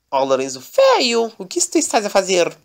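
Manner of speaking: mais agudo, Oh esticado, bronca de personagem; Daniela ralhando. Acted. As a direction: cobra o Lorenzo em voz aguda e arrastada. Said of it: "Daniela era muito rápida e falou assim". (Daniela repreende Lorenzo, voz aguda)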